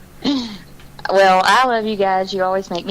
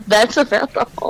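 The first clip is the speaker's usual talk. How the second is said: ordinary delivery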